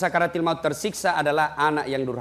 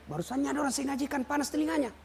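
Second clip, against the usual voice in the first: hushed amazement; 7 semitones higher, 9 dB quieter, faster than usual. Real, not acted. Astonished aside, quick and hushed.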